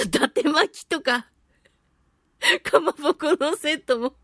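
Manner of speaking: ordinary talk, nothing marked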